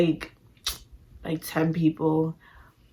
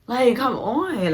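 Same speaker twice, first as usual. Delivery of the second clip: drawn-out, higher whine of protest; slow, raised, fed-up plea; exasperated pleading; 6 semitones higher, much slower than usual. Retold or imitated. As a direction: stretch it slowly, begging in frustration. Pleading with cousin to stay.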